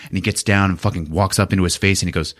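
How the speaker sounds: normal talking voice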